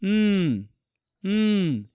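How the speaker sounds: "উম" as a held N hum; N sound demo. Shown as a demonstration. Teacher shows how N is pronounced, held hum. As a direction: hold "উম" as a bare nasal sound. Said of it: "এন এর উচ্চারণ হচ্ছে এমন".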